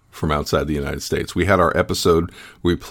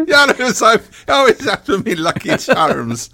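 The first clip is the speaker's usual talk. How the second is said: Irish leprechaun; 4 dB louder than usual. Acted. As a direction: laugh through a leprechaun brogue. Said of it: "Irish bit, Lucky Charms leprechaun".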